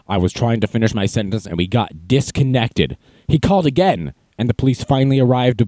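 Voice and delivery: ordinary talk, nothing marked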